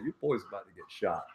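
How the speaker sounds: quiet warning from just behind; hushed heads-up, acted out; low warning. Retold warning from man behind him.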